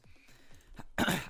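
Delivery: quick unvoiced throat clear. Real, not acted. Tutor pausing his walkthrough, clears throat. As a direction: clear the throat briefly before going on.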